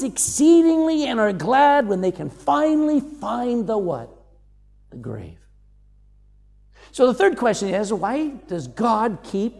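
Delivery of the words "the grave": hushed answer. Real, not acted. Answer to own question.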